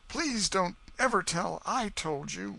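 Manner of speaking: put-on high, slow pleading boy. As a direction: beg them not to tell. Huck begging secrecy.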